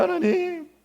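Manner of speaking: a hint of a parent begging God for a sick child; pleading prayer. Preacher imitating a parent praying for an ill child, softly pleading. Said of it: "막 기도하는 거예요".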